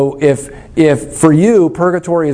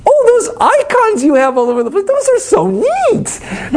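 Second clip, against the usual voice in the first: patronizing gushing. Acted. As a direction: coo condescendingly, as if humoring someone's quaint hobby. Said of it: "open but sort of patronizing".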